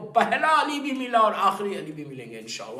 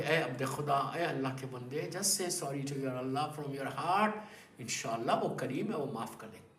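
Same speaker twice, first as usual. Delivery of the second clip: softly, kindly; 6 semitones lower, 7 dB quieter than usual. Retold or imitated. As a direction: gently coax him to repent. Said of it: "بڑی softly".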